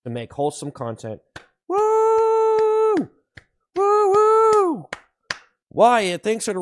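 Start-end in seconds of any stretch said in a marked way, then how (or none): 1.7-4.9 excited cheering. Acted. Hype cheers.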